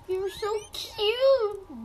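put-on high, slow baby-talk coo. Player cooing at a cute guinea pig, high coo. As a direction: coo at it like a pet.

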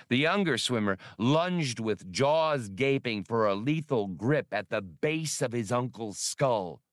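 usual speaking voice

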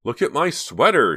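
losing control, cry it out. A narrator's reading. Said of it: "she cried".

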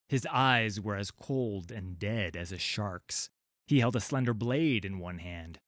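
ordinary delivery, nothing marked

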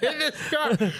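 half-laughing protest; laughing disbelief. Retold or imitated. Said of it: "I'm like".

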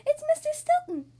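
put-on high, loud, delighted kid cheer; excited squeal of recognition; happy cheer. A narrator's reading. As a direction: cheer at spotting a friend. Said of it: "Kitty cheered happily".

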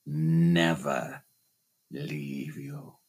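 a hushed low menacing growl; low menacing phantom. Acted. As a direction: press each word in a dark hush. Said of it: "he shouted".

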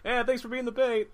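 high, loud put-on voice; mock teammate call-out. Voices teammates teasing the bait player.

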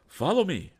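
high, loud call to follow; full-voiced, urgent command; rallying cry. A narrator's reading. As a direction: cry out as you lead the charge. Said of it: "cried the val".

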